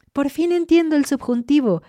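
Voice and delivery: excited announcement. Retold or imitated. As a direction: proudly share the big news with fellow learners. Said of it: "les dices". (anuncio emocionado y orgulloso, mucho más agudo)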